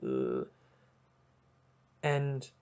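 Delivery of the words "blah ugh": much lower, soft, weary blah-blah; lightly voiced jaded star; mock bored grumble. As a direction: drone it like a tired celebrity. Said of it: "he's like".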